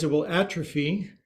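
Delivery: ordinary talk, nothing marked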